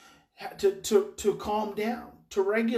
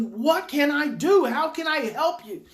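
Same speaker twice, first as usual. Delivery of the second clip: raised, insistent questions; acted parent pleading to help, higher-pitched; emphatic asking; 5 semitones higher, 5 dB louder than usual. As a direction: ask with forceful, urgent concern. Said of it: "just ask him emphatically".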